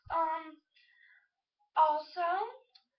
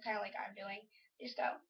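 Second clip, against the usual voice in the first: ordinary talk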